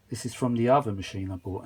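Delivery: ordinary delivery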